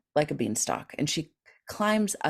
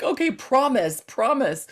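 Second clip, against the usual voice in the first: mock pleading; 8 semitones higher, 4 dB louder than usual. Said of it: "I'm like".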